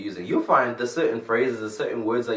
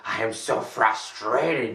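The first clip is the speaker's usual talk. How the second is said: very slow, dragged-out complaint; drawn-out mock frustration; frustrated; much slower than usual. Shown as a demonstration. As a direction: demo of the harsh phrasing. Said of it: "So rather than saying".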